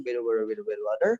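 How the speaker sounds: American flapped t's in "better butter"; American accent. Shown as a demonstration. Teacher showing how Americans say it, American accent. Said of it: "orang Amerika ya bilangnya".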